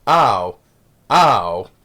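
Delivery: repeated falling computer-voice ow; Stephen Hawking. Retold or imitated. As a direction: imitate a speech computer's yelp. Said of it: "the other way the voice says it".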